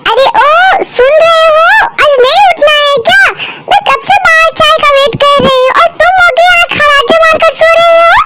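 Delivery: high-pitched scolding wife voice; nagging wife. Acted. Wife scolds sleeping husband. (ऊँची आवाज़ में डाँटती पत्नी)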